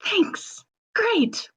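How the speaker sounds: exasperated. Acted. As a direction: snap back in disbelief. Dolma exasperated.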